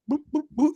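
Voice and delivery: high squeaky boop sounds; raccoon noises. Retold or imitated. Voicing the raccoon at the dumpster, squeaky and high. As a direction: chirp like a little raccoon. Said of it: "he's just like".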